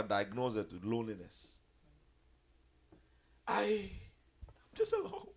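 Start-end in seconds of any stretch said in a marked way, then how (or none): 3.5-5.3 mock wailing. Mocks a lonely believer's wail.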